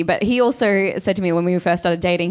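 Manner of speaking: usual speaking voice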